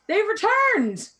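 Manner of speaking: high, slow, loud joyful cry; excited yell. Acted. Villager yelling to the whole village, excited. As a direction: shout the news of their return. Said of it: "excitedly yells".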